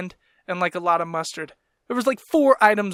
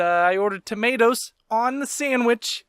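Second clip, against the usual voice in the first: normal talking voice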